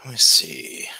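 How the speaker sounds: breathy whisper while fiddling with the setup; whispered to self. Real, not acted. Streamer fixing his broken stream setup, whispering to himself. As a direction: let it out as a whisper, mostly to yourself.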